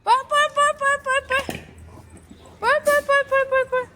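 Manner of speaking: high, lively cheering on; excited urging. Real, not acted. Owner to dog, cheering it on to run, high chant.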